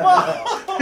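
high, loud laughing whine of "Mom"; cracking up while protesting; laughing exasperated "Mom". Retold or imitated. Son whining at his mom, high and laughing.

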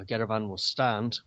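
usual speaking voice

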